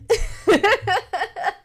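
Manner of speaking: high giggle at own joke; laughing. Real, not acted. Laughs at own silly pronunciation.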